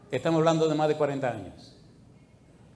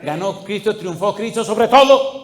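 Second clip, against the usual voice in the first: rallying cry, higher and louder; rising preacher's shout; 5 semitones higher, 10 dB louder, slower than usual. Real, not acted. Preacher rallies congregation on Christ's victory, rising shout. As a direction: build to a high, loud, drawn-out peak.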